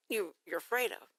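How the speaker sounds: normal talking voice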